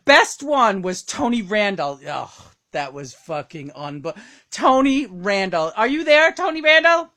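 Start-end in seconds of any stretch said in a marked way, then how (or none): 4.5-7.1 loud, high summons to a guest; calling out. Pretend. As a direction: call out for someone who may not hear.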